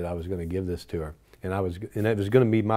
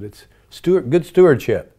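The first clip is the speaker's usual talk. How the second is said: louder, higher insistent pitch; exasperated, raised urgent plea; frustrated pleading; 6 semitones higher, 7 dB louder than usual. Retold or imitated. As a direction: push hard to convince her, voice rising. Pleading with Mother Teresa, frustrated.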